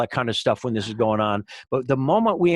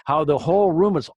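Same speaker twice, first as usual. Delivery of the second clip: normal talking voice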